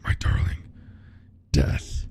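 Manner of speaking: very low, then breathy demonic rasp. Acted. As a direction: boom it out like a demon from the dark. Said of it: "There's a better voice for that".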